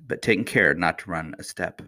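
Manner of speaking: ordinary delivery, nothing marked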